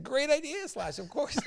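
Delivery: very high, fawning mock chorus; high-pitched yes-man enthusiasm; gushing agreement. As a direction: squeal your eager approval. Everyone gushing at the star.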